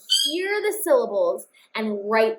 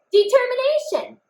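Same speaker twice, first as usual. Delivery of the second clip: Determination said much higher, quick and proud; triumphant read; 12 semitones higher than usual. Shown as a demonstration. Teacher celebrates the finished spelling with the class. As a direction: pop it out like a win. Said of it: "I wrote all the sounds that I heard".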